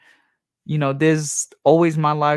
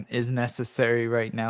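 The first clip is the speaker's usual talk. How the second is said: normal talking voice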